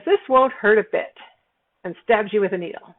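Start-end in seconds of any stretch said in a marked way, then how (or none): 0.0-1.2 nurse. Retold or imitated. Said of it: "The nurse says".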